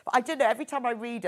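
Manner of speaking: ordinary talk, nothing marked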